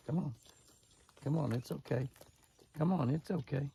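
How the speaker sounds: high, reassuring coax; coaxing call to deer. Real, not acted.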